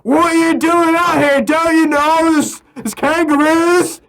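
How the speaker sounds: high-pitched hammed-up Australian copper; Australian police officer questioning lost travellers, mock Australian accent. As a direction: play an Aussie cop barking at strangers. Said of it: "the Australian police come, like".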